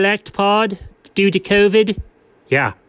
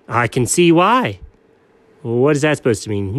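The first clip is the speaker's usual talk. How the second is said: ordinary talk, nothing marked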